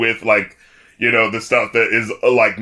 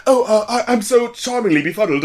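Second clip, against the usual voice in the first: mock British accent, higher, bumbling; British charm; 9 semitones higher than usual. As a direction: play a befuddled charming Englishman. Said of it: "that little British charm".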